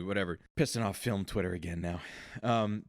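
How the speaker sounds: ordinary talk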